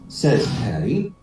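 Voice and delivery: ordinary talk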